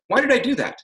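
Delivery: high, light, curious question; genuinely wonder, open to an answer. Shown as a demonstration. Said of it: "It says I'm open to an answer".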